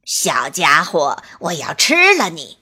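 loom over the little squirrel; storybook wolf threatening his prey; fierce big bad wolf. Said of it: "恶狠狠地说".

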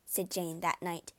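ordinary delivery

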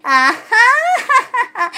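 high, slow ha-ha-ha; gleeful fox laugh. A narrator's reading. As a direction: laugh out in delight. Fox delighted by talking bone. (高而慢的狐狸大笑)

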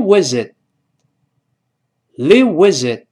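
"live with it" run together as one word; "live with it" linked, final t held back. Shown as a demonstration. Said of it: "放在一块儿连读".

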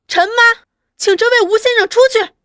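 音调很高、声音很大、语速偏快地朝里屋喊; 怒气未消，冲内屋高声叫人; calling out angrily. A narrator's reading. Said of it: "对内又叫着". (愤怒地高声呼唤)

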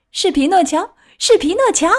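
high-pitched squeal, climbing on the repeat; shrill cry. A narrator's reading. Puppet lady pokes out from backstage, shrill cry. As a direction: shriek it as an excited puppet lady. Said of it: "尖声叫道".

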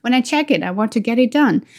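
ordinary talk

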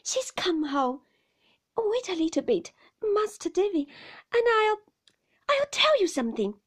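loud, high, agitated nurse. A narrator's reading. Agitated nurse puts off child.

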